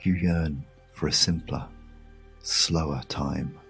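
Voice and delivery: ordinary delivery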